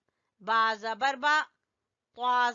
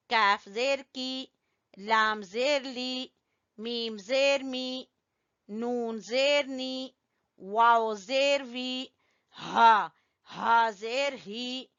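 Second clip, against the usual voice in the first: ordinary talk, nothing marked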